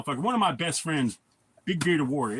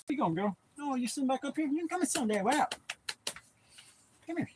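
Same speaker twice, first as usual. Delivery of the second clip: pet talk; 8 semitones higher, 5 dB quieter than usual. Real, not acted. Talking to his dog on his lap, high soft coo.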